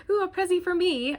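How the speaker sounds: high, loud-ish mock English accent; badly faked English accent, gleefully overdone; lame English accent. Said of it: "That was my lame accent".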